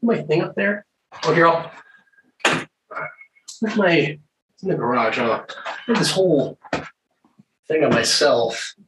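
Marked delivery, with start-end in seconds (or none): none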